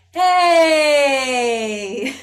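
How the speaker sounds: big loud "Hey!" thrown far; calling out. Shown as a demonstration. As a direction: call across the room. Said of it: "let's throw a sound ball".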